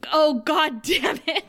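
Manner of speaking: imitated mortified groan of words; embarrassed frustration. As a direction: act out a man cursing what is overtaking him. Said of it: "he goes from embarrassment to frustration".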